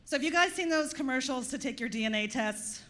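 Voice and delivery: normal talking voice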